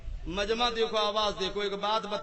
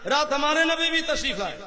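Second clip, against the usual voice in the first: rising punchline; 6 semitones higher, 10 dB louder than usual. Retold or imitated. Punchline of joke, building.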